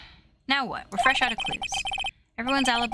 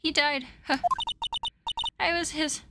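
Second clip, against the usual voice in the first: lightly voiced, breathless old woman grumbling; a hint of a cranky old lady; winded old lady; 7 semitones higher than usual. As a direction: huff through the threats like a tired grandma. Old lady character lines.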